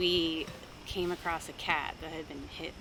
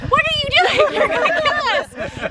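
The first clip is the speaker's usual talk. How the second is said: much higher and louder, a full alarmed shout; panicked yell; 13 dB louder than usual. Retold or imitated. Scolding mom after her sudden swerve, alarmed shout. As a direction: yell it like the car's about to crash. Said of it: "angry in a different way".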